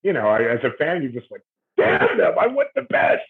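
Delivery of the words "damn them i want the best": mock fan frustration. Frustrated fan, retold inner voice.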